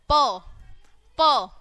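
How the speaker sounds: प as a loud, isolated "puh"; phonics sound. Shown as a demonstration. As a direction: punch out प as a letter sound. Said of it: "पी की sound कैसे निकलती है". (प को ज़ोर से, अलग बोलें)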